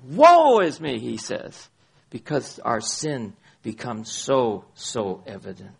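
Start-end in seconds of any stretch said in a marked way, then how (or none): 0.0-1.0 loud cry of woe; a touch of lament. Shown as a demonstration. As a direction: call out woe in anguish.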